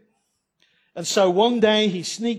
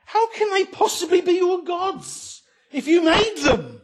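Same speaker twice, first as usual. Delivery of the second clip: exasperated retort; 12 semitones higher than usual. Retold or imitated. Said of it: "you feel like saying".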